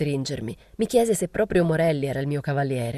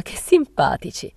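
louder, drawn-out slow exclamation; mild exclamation; 3 semitones higher, 6 dB louder, slower than usual. A narrator's reading. Brunette reacts to talk of unpaid debts, drawn-out cry. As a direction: cry it out, stretching the words. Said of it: "gridò la bruna".

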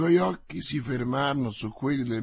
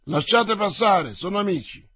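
order to his men; 6 semitones higher, 5 dB louder than usual. Acted. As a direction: command the patrol to let them through. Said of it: "ordinò". (ordine deciso e forte)

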